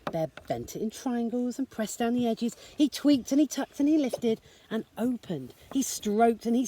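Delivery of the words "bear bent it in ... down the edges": ordinary delivery, nothing marked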